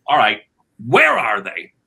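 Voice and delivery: loud, blustering demand; barging father. Retold or imitated. Father barges home demanding to find the kids, loud and blustering. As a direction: storm in like an angry dad. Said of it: "I barge in".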